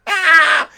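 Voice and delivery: high, loud shriek; storybook scream of fright; father's scream. Acted. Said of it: "he screamed".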